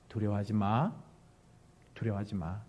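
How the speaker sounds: low, soft, gentle reassurance; very warm. Shown as a demonstration. Preacher voicing Moses to frightened people, warm reassurance. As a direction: soothe them softly, unhurried. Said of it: "굉장히 따뜻하게".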